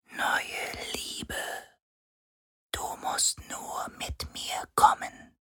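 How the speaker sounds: slow, soft unvoiced ghostly whisper; tempting shadow whisper. A narrator's reading. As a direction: lure him in like a phantom. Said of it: "Eine schöne, klagende Stimme".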